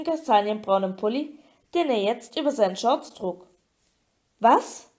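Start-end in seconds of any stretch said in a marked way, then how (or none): 4.4-4.9 sharply rising question; a short, startled gasp of a word; shocked gasp. A narrator's reading. Harry hears what the merpeople took, shocked gasp. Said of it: "keuchte Harry".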